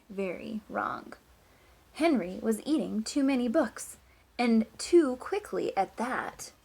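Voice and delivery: lively, sing-song storybook reading; reading to children. Acted. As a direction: read it like story time for a class. Teacher reads picture book aloud.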